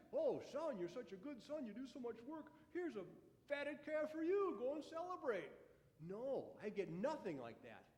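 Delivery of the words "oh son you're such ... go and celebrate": mock fatherly praise. Imagined father praising older son.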